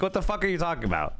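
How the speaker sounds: ordinary talk, nothing marked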